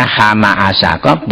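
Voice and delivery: "Hama Asako" blended into plain words; misread letters. Shown as a demonstration. Debater repeats the wrong reading as proof, "Hama Asako" run together. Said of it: "kalau dibaca".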